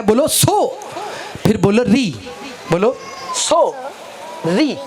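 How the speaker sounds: "सो" lifted at the end, pause, then "री"; syllable-by-syllable sorry. Shown as a demonstration. Father coaching a small word, "सो" and "री" said apart. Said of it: "सिर्फ ये बोलना है आपको".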